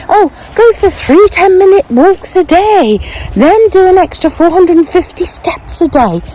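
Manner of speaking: high, incredulous read-out; exasperated disbelief. Retold or imitated. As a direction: read it like it's ridiculous. Reading out absurd walking challenges.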